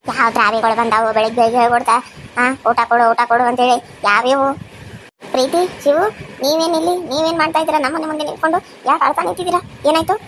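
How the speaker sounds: a gruff aunt surprised at the door. Acted. Same speaker voices the aunt. (ಗಡಸು ಧ್ವನಿಯ ಅತ್ತೆ)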